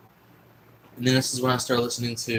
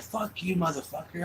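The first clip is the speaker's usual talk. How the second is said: retold curse, pitched much higher; angry insult; 7 semitones higher, 5 dB quieter than usual. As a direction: fling the insult in a heated fight. Friend's insult in retold fight.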